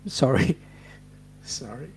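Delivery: sheepish chuckled sorry; laughing sorry. Real, not acted. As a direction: laugh at own joke while apologising. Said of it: "I'm gonna crack myself up".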